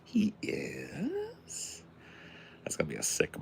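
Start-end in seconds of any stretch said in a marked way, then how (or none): 0.0-1.8 a whispered, much higher "He is?"; surprised whisper. Real, not acted. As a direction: breathe out the surprise. Surprised news about Bond director.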